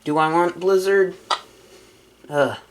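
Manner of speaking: ordinary talk, nothing marked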